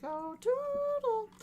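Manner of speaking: very high, slow sing-song gush; cartoonish delight. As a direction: coo it like a kids' show fan. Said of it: "picturing all the nato people like".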